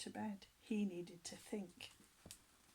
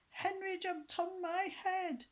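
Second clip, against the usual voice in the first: high, slow whiny whimper; tearful tattling whine; snivelling; 11 semitones higher, 3 dB louder, slower than usual. A narrator's reading. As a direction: snivel it like a hurt little brother. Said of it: "snivelled a familiar voice".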